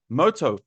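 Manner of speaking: moto, ending on an open O; motor without R. Shown as a demonstration. Teacher showing the confusing word, motor without R. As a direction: drop the R from motor. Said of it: "when you say this word".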